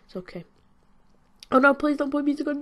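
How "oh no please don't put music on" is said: higher, louder playful protest; mock panic. Real, not acted. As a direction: beg them in a hammed-up panic. Someone starts playing music.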